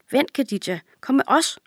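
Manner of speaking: calling out. A narrator's reading. Girl calling to her friend to come along. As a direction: call her friend back to join. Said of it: "råber hun så".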